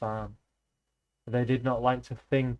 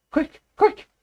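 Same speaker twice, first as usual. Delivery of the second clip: high, loud alarmed shout; 10 dB louder than usual. A narrator's reading. Benjamin galloping up to warn the animals, loud alarmed shout. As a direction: bray out the alarm. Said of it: "he shouted".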